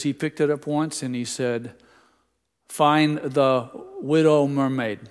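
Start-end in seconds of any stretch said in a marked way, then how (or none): 2.7-5.0 "Widow" said for "Little", loud and slow; imitated toddler talk. Grandson asks the TV remote for his favorite movie, "Widow" in toddler talk. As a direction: imitate a small child saying "Widow" for "Little". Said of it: "He is so Dutchy".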